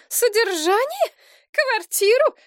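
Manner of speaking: high, loud, slow disbelieving shriek. A narrator's reading. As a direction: squeal the words out in outrage. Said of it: "взвизгнула Елена".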